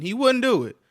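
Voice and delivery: high, loud, firm; heated insistence. Real, not acted. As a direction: hammer the point home. Heated argument about the father.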